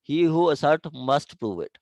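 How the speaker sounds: ordinary delivery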